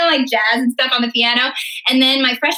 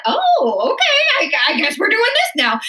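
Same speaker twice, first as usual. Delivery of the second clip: high, startled "oh, okay"; mock surprise; 9 semitones higher than usual. Recalling being cast as Mabel, high mock surprise. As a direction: play it caught off guard. Retelling surprise at getting the lead.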